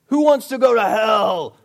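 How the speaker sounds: loud, hammed-up shouted challenge; mock preacher yell. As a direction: holler it at a crowd of kids. Acting the yelling camp preacher.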